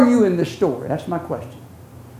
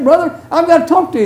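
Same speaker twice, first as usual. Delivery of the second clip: quick, higher and louder urgent plea; eager call; 8 semitones higher, 4 dB louder, faster than usual. Retold or imitated. Preacher retelling his call to his brother, quick and eager. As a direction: rush it out, keen to make peace.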